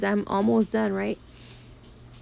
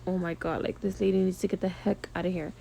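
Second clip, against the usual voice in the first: softer, fast inner grumble; under your breath, fed up; muttering to herself; 7 dB quieter, faster than usual. Retold or imitated. Nail tech thinking about the rude client, quiet grumble. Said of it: "I was just kinda like".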